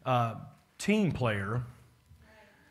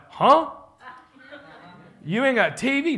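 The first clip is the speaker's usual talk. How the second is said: mock astonished, much higher and louder; loud, high imitated disbelief; shocked disbelief; 10 semitones higher, 5 dB louder than usual. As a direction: act floored that anyone lives without TV. Saleswoman shocked he has no TV.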